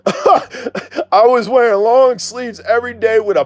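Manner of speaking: slow, high-pitched, amused telling; laugh, then draw it out high and slow; tickled by the memory, pitched well up. Real, not acted. Laughs recalling his cover-up outfit.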